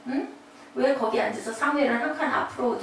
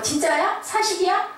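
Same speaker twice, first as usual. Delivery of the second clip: higher, incredulous questions; acted disbelief; 7 semitones higher than usual. Parent first hearing of the accident, incredulous rising questions. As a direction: question it in mock shock, pitch climbing. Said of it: "처음에는 놀래고 인정하고 싶지 않고".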